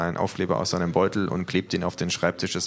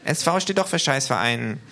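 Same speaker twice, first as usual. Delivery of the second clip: higher-pitched, cheeky kangaroo; 7 semitones higher, 3 dB louder than usual. Acted. Kangaroo to official about badge, high voice. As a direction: insist on it in a high voice.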